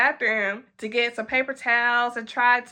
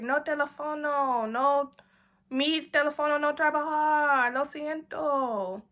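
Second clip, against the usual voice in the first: soft, hammed Spanish excuse; mock Spanish excuse; 3 semitones higher, 4 dB quieter than usual. Dodging Peter's number ask with a soft broken-Spanish excuse.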